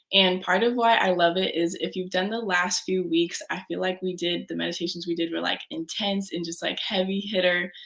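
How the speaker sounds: ordinary talk, nothing marked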